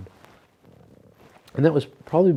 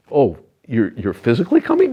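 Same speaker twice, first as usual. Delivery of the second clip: louder, higher, mock disbelieving question; retold astonishment; 3 semitones higher, 10 dB louder than usual. Guest retells asking Murtiana if Swami comes, astonished. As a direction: replay the shock of hearing he's really coming.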